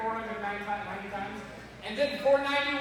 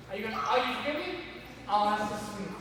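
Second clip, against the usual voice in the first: usual speaking voice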